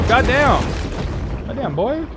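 high, drawn-out amazed goddamn; awed exclamation. Real, not acted. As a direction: marvel at the size of it. Awed at a huge shark.

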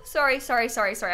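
ordinary talk, nothing marked

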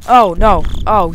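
loud frightened shout; scared yelp. Real, not acted. Player startled by Slenderman, loud scared yelp. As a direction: yelp it out as something jumps you. Sudden scare in horror game.

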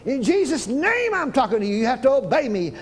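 preacher rebukes cancer in a shouted command. Retold or imitated. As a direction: command the sickness to leave. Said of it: "you have to talk just like that".

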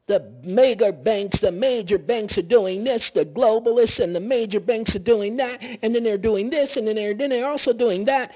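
high, loud sarcastic parody of conspiracy talk; mocking rant. Mocking what people usually say.

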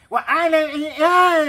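high, loud garbled yell; mock garbled shout. The gangoso shouting into the cave, garbled yell. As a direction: yell unclear sounds into a cave. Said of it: "le grita el cangón".

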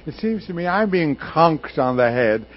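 put-on loud, high British stage accent; British actor complaining in a booming voice. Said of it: "in his very British accent".

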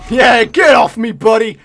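loud, slowed, punchy yell; triumphant shout. Real, not acted. Gamer yelling at an enemy who jumped him, loud and triumphant. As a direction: bellow it as you win the fight.

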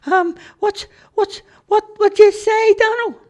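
very high, flustered stutter; frightened high cartoon stammer; stammering squeaky Charlie. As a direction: squeak and trip over the words. Charlie stammering after falling.

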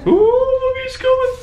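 very high scared squeal; frightened squeal. Real, not acted. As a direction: squeal as he comes. Monster approaching.

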